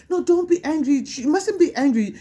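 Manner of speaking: high, sing-song mock reproach; dismissive scolder. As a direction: imitate someone telling off a child, pitched right up. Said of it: "no one's allowed to tell you".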